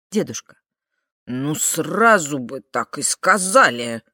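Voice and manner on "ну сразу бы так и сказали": put-on slow, drawling old man voice; drawn-out words. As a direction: stretch out every word, disappointed. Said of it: "растягивая каждое слово".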